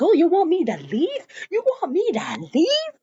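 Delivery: high-pitched mock outrage, rising at the end; indignant challenge. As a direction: act out an incredulous wife challenging him. Retold marital row, indignant challenge.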